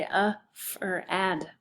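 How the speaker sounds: "a f-, or ad" in separate sounds with a short A in "ad"; tapped out. Shown as a demonstration. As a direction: sound out the misspelling "a f-, or ad" piece by piece. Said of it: "if I tapped that out".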